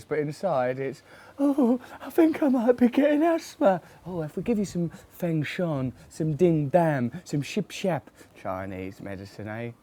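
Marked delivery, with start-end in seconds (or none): 1.4-8.1 high, fussy voice fretting about asthma; fussy hypochondriac. Pretend. Inner self fretting over herbs, in a high fussy voice. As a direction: camp it up as a fretful health nut.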